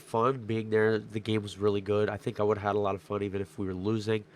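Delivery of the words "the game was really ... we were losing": ordinary talk, nothing marked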